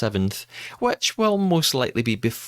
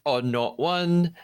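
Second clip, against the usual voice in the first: normal talking voice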